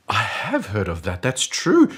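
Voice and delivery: rising into high, loud agreement; mock revelation. Colleague won over by the boss, hammed-up eager agreement. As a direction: agree as if suddenly enlightened.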